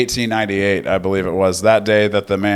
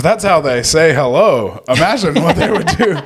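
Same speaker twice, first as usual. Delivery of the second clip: higher and fast, laughing through it; 7 semitones higher, 3 dB louder than usual. Real, not acted. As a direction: joke while cracking up. Joking about the cursing greeting.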